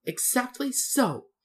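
high, slow, gleeful agreement; self-satisfied little man; pleased. A narrator's reading. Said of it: "as if it pleased him".

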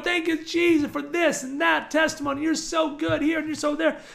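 high, running praise, replayed from the car; acted-out prayer of praise; reenacted thanksgiving. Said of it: "I started thanking the Lord".

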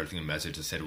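ordinary talk, nothing marked

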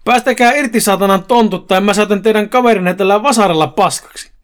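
acted angry yell; shouted threat. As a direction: holler a threat at the attackers. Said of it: "Joni oli saapunut paikalle ja huusi".

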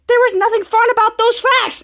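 high-pitched mock exasperation; exasperated complaint. Volt fed up with fun facts.

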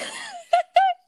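high shrieking laugh; burst of laughter. Real, not acted. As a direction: crack up laughing. Laughing at the story.

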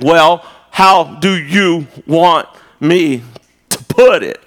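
exaggeratedly measured comeback; sarcastically slow. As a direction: spell it out word by word with sarcasm. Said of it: "I say things slower, which is sarcastic".

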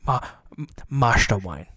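low, put-on Cockney actor; Michael Caine. Co-host copying the host's impression, deep. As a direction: try out a bad impression in a low voice. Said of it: "as Michael Caine".

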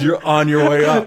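a cheery pep-talk punchline; mock-encouraging brother. Brother cheering him on over the phone.

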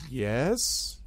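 tentative rising question; clueless. Acted. Goblin addressed in words he misses, puzzled question. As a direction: answer with no idea what was meant. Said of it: "seems to have no idea".

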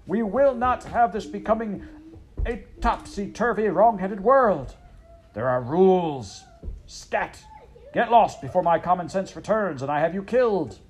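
a hammed-up royal fury; raging king. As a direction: rage at the mouse to get out.

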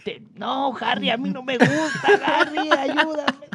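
put-on high, pleading older man calling for help; imitating older coworker. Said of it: "tengo que imitar su voz". (voz aguda y suplicante de señor mayor)

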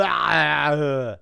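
long, loud mock yell; drawn-out strangled action-hero cry; imitated roar of agony; Schwarzenegger. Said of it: "where is Schwarzenegger gonna go".

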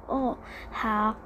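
small, soft, nervous assent; mildly nervous. A narrator's reading. 阿果 answers the scolding driver, softly nervous. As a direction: answer meekly, a touch nervous. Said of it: "阿果紧张地说".

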